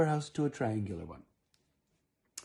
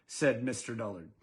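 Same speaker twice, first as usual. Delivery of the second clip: plain reading voice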